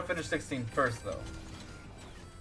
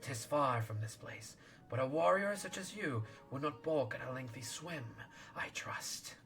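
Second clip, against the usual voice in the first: soft, hissing serpent lord; 9 dB quieter, slower than usual. Acted. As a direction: breathe it like a sly sea serpent. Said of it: "I'll do serpent voice then".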